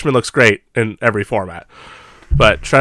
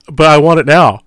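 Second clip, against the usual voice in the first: whiny customer; 4 semitones higher, 7 dB louder than usual. Retold or imitated. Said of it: "You're like".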